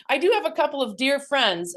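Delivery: delighted, quick; excited. Real, not acted. Delighted greeting friends.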